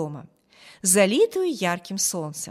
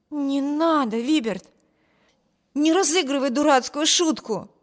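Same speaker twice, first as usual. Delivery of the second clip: higher-pitched, urgent appeal; exclaimed protest; 5 semitones higher than usual. A narrator's reading. Sister tells brother not to play jokes, protesting. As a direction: plead with him to drop it. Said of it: "воскликнула Эми".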